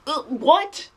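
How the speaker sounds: a high baffled what; incredulous. Real, not acted. Reviewer reacting to terrible action, high incredulous what.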